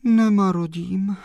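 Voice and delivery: high, plaintive sigh of words; lamenting young woman's high voice. A narrator's reading. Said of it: "zavzdychla slečna Mařenka".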